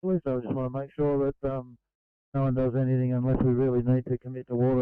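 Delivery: tinny two-way radio voice; crackly construction walkie talkie. Real, not acted. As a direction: speak through a crackling handheld radio.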